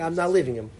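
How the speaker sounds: ordinary talk, nothing marked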